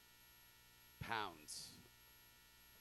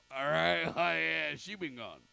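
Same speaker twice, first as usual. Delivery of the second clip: usual speaking voice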